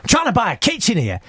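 high, indignant retort; imitating an irritated celebrity's complaint; mock exasperation. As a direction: protest like a pestered customer. Acted annoyed celebrity answering fan.

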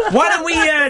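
breaking into high laughter; laughing. Real, not acted. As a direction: laugh through the words. Laughing at own remark.

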